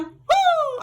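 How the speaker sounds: high celebratory whoop; excited whoop. Real, not acted. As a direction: let out a gleeful woo. Celebrating the verdict.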